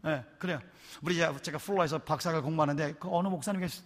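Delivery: ordinary delivery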